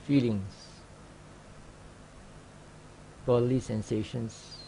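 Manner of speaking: calm, measured meditation guidance with long pauses; unhurried, even voice, leaving silence between short phrases; guided meditation. Acted. Teacher guiding students through sitting awareness practice, calm guided meditation.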